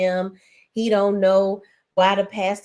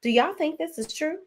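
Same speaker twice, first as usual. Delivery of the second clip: higher, softer questioning imitation; Jefferson quoting; 8 semitones higher, 5 dB quieter than usual. Retelling the pastor asking the ladies, higher softer voice. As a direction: mimic a pastor polling the room. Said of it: "Jefferson asked the ladies".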